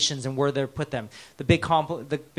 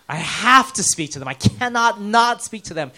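higher, louder retold urgency; urgent inner plea; 7 semitones higher, 7 dB louder than usual. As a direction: stress the desperate need to speak. Acts out his urgent inner voice.

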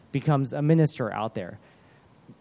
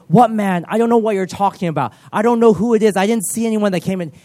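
flustered denial; 4 semitones higher, 5 dB louder than usual. Retold or imitated. Guard denying to angry general.

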